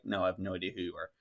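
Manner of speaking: soft, quick, low-pitched reply; calmly defusing, softly; nice, calm. Retold or imitated. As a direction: retell a polite denial, keeping it quiet. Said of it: "I'm very nice about it".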